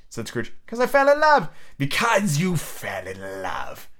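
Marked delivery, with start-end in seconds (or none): 0.7-1.5 high, eager answer; Scrooge's nephew, ardent. Acted. Nephew defending his marriage to Scrooge, high eager voice. 1.8-3.9 low, very slow scornful echo; growling Scrooge. A narrator's reading. Scrooge mocking his nephew's love, slow low growl. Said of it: "growled Scrooge".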